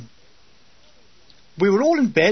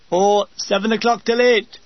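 lightly voiced dim orderly; dopey orderly; 3 semitones higher, 3 dB louder than usual.

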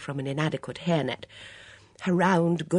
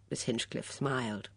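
ordinary delivery

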